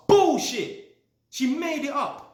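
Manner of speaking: a high, loud scoffing shout; indignant outburst. Real, not acted. Landlord scoffing at the tenant's lost-key story, loud indignant shout. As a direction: call out the lie, loud and high.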